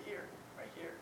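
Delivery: faint whisper, nearly silent; quietly whispered reply; whispered answer. Retold or imitated. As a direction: answer in a hush as if to God. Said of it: "I've been able to say".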